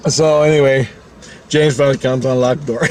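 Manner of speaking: ordinary talk, nothing marked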